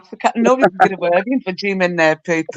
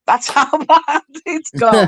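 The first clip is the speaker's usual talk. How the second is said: laughing; 6 semitones higher, much slower than usual. Real, not acted. Reading out a silly comment.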